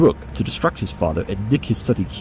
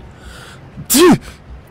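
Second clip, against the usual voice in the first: smug laugh; 9 dB louder than usual. Acted. Sherlock laughs after the explanation.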